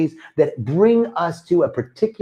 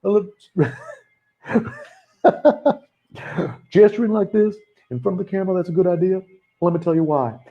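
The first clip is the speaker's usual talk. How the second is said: higher-pitched, laughing politician's pitch voice; Bill Clinton impression; 5 semitones higher than usual. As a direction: play a smooth politician selling the retreat on camera. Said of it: "That's my Bill Clinton impersonation".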